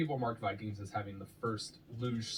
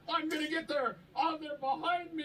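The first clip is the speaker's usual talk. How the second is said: very high, wailing mock panic; panicked imagined racer. Comedian imagining a helpless sled ride, high mock panic. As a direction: squeal it like a helpless sledder. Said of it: "So I just imagine I'm like".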